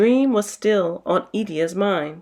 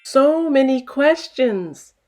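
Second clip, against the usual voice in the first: lightly amused father; 5 semitones higher, slower than usual. Acted.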